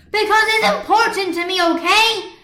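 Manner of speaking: loud, high, snapping back; snapped. A narrator's reading. Marinette snapping at Adrian in class, loud angry. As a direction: bite the words off at him. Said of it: "Marinette snapped".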